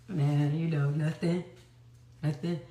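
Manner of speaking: low, slurred-sounding ex mumbling; a hint of a wasted ex dodging the question; mumbled brush-off. High ex brushing her off.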